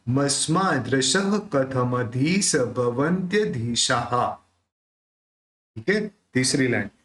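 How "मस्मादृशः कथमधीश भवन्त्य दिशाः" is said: मस्मादृशः and दिशाः with echoing visarga, slowly; slow model recitation. Shown as a demonstration. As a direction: model it clearly for learners. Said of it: "तो पूरा क्या निकलेगा?".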